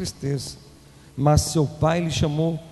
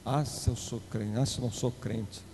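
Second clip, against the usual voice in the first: mock muttered threat; 7 semitones lower, 9 dB quieter than usual. Mocking a believer's threat.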